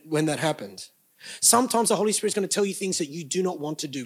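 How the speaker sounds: normal talking voice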